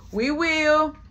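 smug reply. Retold or imitated.

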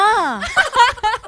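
loud high laugh at herself; high burst of laughter. Real, not acted. Laughing at her own caregiver mix-up, high loud laughter.

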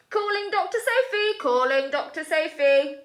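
high, loud urgent radio call. Acted. Luther radioing Doctor Sophie for help, high and urgent.

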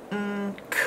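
"Mm, k" very soft; soft sounded out. Shown as a demonstration. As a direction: whisper the end sounds. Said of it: "There's our diphthong".

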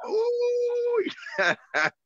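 high ecstatic ooh; joyful ooh. Real, not acted. Pastor crying ooh in praise.

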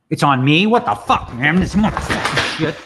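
loud, high-pitched fed-up swearing; exasperated outburst. Real, not acted. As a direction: blow up at the frozen software. Poker software freezes on his turn.

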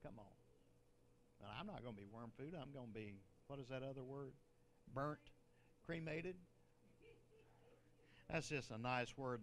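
normal talking voice

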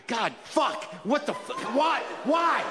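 mock-anguished cry building to a high shout; a bored man snapping, voice climbing to a yelp on why; rising mock despair, ending in a high shout; exasperated outburst. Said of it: "and then I'm like".

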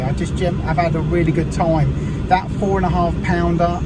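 loud, upbeat to-camera wrap-up; vlog outro. Acted. Angler thanking the fishery owners to camera, loud and upbeat. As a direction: sign off cheerfully to your viewers.

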